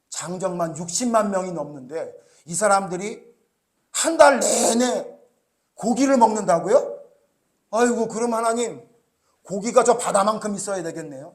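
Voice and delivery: incredulous Moses. Retold or imitated. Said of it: "모세가 하나님께 대들어요".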